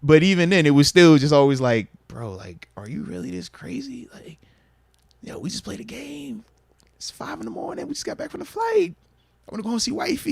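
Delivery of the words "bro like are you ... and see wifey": hushed, high, weary grumble to himself; half-whispered complaint at five in the morning; mock tired whisper. Exhausted player grumbling to himself after the flight, hushed and high. Retelling his own tired, exasperated thoughts.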